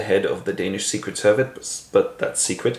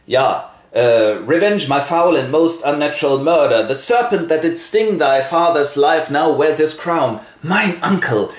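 louder Darth Vader ghost voice; ghost with a cold; 4 semitones higher, 4 dB louder than usual. Acted. The ghost tells Hamlet the truth, in a louder Vader voice. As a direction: boom it like a masked ghost with a head cold. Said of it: "seems to have caught a cold".

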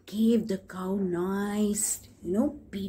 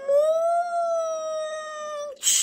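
cow moo. Acted.

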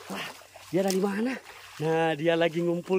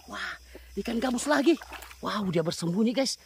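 usual speaking voice

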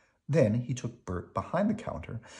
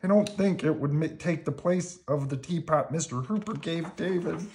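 high, forlorn puppet voice; wistful high character voice; Bert; 8 semitones higher than usual. A narrator's reading. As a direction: explain sadly, pitched up. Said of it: "he said".

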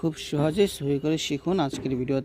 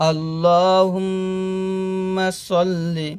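drawn-out chanted Arabic; measured, sustained teaching recitation; slow recitation; 3 semitones higher, 4 dB louder, much slower than usual. Acted. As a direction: chant slowly, humming the doubled m.

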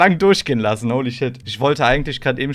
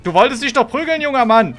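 mocking taunt; 7 semitones higher, 5 dB louder than usual. Taunting a player who wanted fight.

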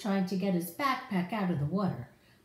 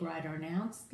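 plain reading voice

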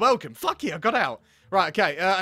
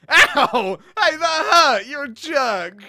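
mock hurt yell; 6 semitones higher, 8 dB louder, much slower than usual. Staged fight, hit.

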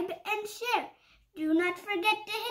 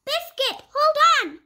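higher, louder call; 8 semitones higher, 8 dB louder than usual. Acted. Owner calling the puppy on the sled, louder and higher. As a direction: call after the sledding dog.

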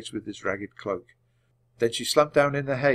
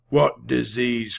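growl; 3 dB louder, slower than usual. A narrator's reading. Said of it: "growled the rider".

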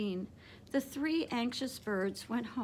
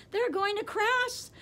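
much higher, louder alarmed gasp; 10 semitones higher, 6 dB louder than usual. A narrator's reading. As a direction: catch your breath in fear for friends. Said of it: "gasped Stella Luna".

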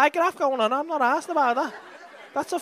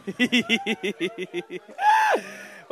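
laughing. Real, not acted. Comedian cracks up at his own teasing, laughing. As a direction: burst into laughter.

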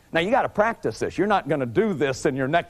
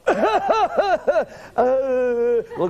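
laughing; 8 semitones higher than usual. Real, not acted. Laughing at exaggerating feelings.

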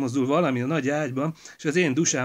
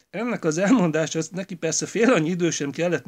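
usual speaking voice

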